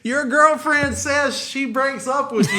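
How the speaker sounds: high, slow called-out message; yelled from the snow. Retold or imitated. Kid yelling up from the snow to the boyfriend, high slow call. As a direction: deliver the news from far below. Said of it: "Yells it from the snow".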